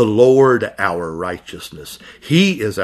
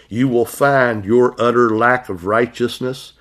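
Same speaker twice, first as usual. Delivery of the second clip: usual speaking voice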